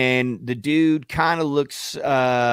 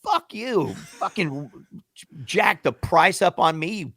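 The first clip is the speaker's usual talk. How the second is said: high, strained retold yell without volume; mock furious comeback; 5 semitones higher than usual. Rider accuses cabbie of jacking the price, mock furious. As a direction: spit the accusation back, pitched up.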